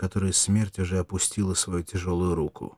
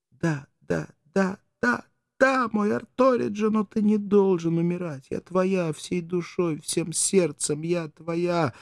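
much higher, slow tender insistence; fervent vows; 10 semitones higher, slower than usual. Acted. Mirdza fervently vows to her dying lover. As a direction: pour your whole heart out.

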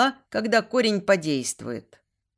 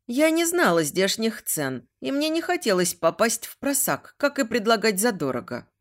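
plain reading voice

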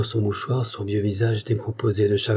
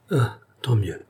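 murmured in relief. A narrator's reading. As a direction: breathe it out, relieved, in a whisper. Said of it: "murmura-t-elle avec un soupir de soulagement". (murmuré dans un souffle soulagé)

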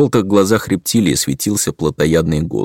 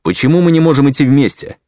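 stubborn; 8 semitones higher, 5 dB louder than usual. Acted. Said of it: "упрямо возразил Атор".

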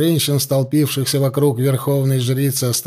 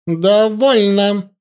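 резкий медленный окрик, выше и громче; обрывает разговор одним словом; рявкнула; 7 semitones higher, 4 dB louder, much slower than usual. Acted. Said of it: "рявкнула ситха". (ситха рявкает на племянницу)

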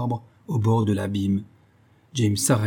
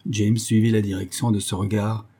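ordinary delivery

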